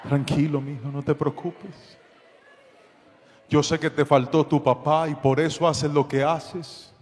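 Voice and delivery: gentle pastor. Retold or imitated. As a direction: soothe him like a frightened son. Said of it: "me transformé en pastor".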